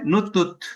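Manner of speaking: "Nutut" cut short on the final T. Shown as a demonstration. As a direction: say "Nutut" as an odd, abrupt little word. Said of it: "muy corto". ("Nutut" muy corto, cortado en la T)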